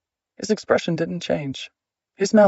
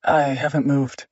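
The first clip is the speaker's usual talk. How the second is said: emotion held out of the words; forced flat. A narrator's reading. Wife guarding against her husband's gaslighting, forced flat. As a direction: keep every word deliberately flat. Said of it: "She forced her voice to stay flat".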